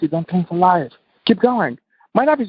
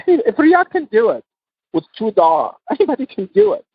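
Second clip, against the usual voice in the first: laughing it out, pitch jumping higher; 8 semitones higher than usual. Real, not acted. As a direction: chuckle through the words, amused at yourself. Laughing at his own two dollars.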